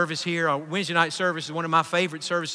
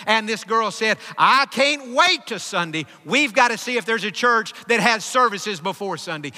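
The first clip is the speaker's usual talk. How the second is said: raised, forceful pulpit storytelling; impassioned preaching; 4 semitones higher, 4 dB louder than usual. Retold or imitated. Preacher retelling a girl's search for a church, impassioned. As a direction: preach the retold conversation with urgency.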